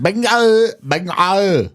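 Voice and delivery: put-on very high, bouncy Vietnamese accent. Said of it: "are you doing the alien or the Vietnamese guy".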